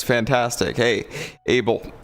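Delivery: ordinary talk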